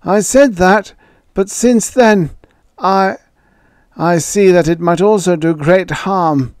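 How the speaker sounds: put-on high, loud faltering old voice; an old man stumbling over his doubts; hesitant old man. A narrator's reading. Ewing faltering to Joey, high old man's voice.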